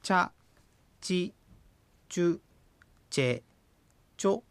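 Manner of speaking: チャ、チ、チュ、チェ、チョ each syllable said apart; syllable demo. Shown as a demonstration. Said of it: "発音の仕方を私が言っていきます". (チャ行をひとつずつ発音)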